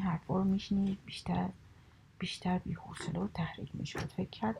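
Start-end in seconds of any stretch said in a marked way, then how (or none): none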